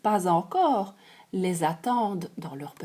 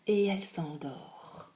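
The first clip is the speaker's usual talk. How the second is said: soft sleepy whisper, falling away; 5 semitones lower, 9 dB quieter than usual. A narrator's reading. Narrator whispering as Goldilocks falls asleep. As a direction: let it drift off into a whisper.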